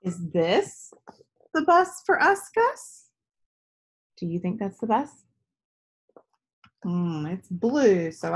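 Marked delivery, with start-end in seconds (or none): 0.0-3.1 put-on high, bright hopeful question, a child's voice; little girl hoping each vehicle is her bus; eager little girl. Girl Tess asks in story.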